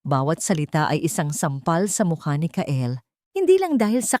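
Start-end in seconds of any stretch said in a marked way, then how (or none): none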